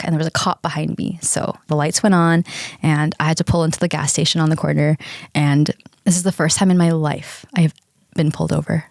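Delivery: ordinary talk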